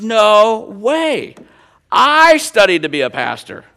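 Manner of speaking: high, loud, astonished imitation; hammed-up, incredulous exclamation; amazed disbelief. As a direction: act out a coworker's amazement, drawing out the first words. Coworker amazed by shared studies.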